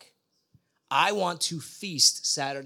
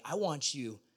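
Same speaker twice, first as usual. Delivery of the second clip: ordinary talk, nothing marked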